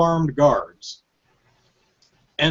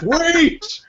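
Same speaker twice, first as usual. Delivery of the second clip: very high, rising cry; Big Boss. Acted. Boss bursting in to stop the shooting, hammed-up yelp. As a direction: stretch it upward into a comic squeal. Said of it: "Big Boss comes in and goes".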